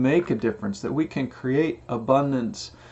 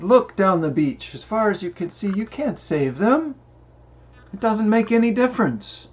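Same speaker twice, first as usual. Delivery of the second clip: put-on lecturing old man; 8 semitones higher than usual. Old man argues with boy.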